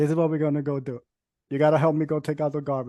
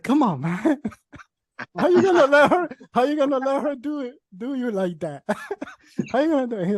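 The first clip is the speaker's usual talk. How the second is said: high, laughing mock scolding of his son; mock exasperated dad; 8 semitones higher, 4 dB louder than usual. Teasing son for whining about her climbing, laughing. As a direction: tease the son in a higher, laughing voice. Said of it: "I'm like".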